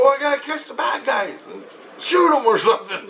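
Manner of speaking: high-pitched retold excuse; protesting. Husband argues with his wife, high laughing protest. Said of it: "And I go".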